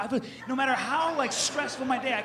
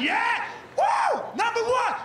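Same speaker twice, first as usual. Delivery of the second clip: high, loud sports yell; stadium cheer; 13 semitones higher, 4 dB louder, slower than usual. Retold or imitated. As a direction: cheer your team like a fan. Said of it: "That's how I got".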